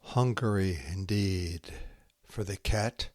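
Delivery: slow, hushed haiku recital with long pauses; poem reading. Acted. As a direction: let each haiku settle into silence. Reads Santoka haiku aloud.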